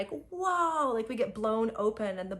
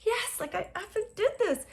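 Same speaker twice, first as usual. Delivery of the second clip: high, thrilled cheer; elated self-celebration; triumphant yes; 13 semitones higher than usual. Retold or imitated. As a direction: cheer for yourself. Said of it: "I was like".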